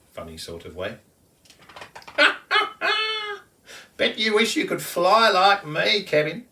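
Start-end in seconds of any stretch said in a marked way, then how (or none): 1.8-3.4 very high, loud bird laugh; a laughing bird's cackle from the branch; kookaburra cackle. A narrator's reading. Kookaburra Colin laughs again. 3.9-6.4 high, loud cheeky bird voice; teasing kookaburra. A narrator's reading. Colin the kookaburra teases Kevin.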